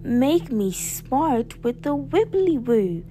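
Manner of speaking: high, slow, drawn-out spell chant; theatrical witch's incantation. A narrator's reading. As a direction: cast the spell with swooping, stretched words.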